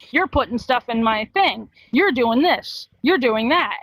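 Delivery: ordinary talk, nothing marked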